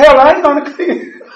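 high, loud defiant refusal; stubborn shout. Retold or imitated. Telling the sponsee he won't clean the house, in a loud defiant shout. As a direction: bark it back, not backing down.

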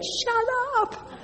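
high, soft voice inside her head; a sudden high hush from within; inner voice. Retold or imitated. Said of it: "something in my head said".

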